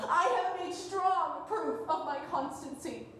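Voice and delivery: usual speaking voice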